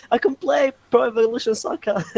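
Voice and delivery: held-back, very high celebratory scream; mock excited scream. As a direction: squeal it like an overjoyed gamer. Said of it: "I start screaming".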